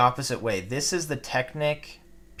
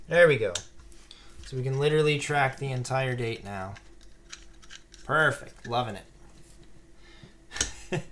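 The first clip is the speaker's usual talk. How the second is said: ordinary delivery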